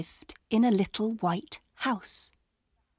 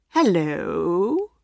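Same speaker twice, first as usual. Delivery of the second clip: low, sly wolf greeting; a hint of a big bad wolf; 3 semitones lower than usual. A narrator's reading. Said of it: "said the wolf".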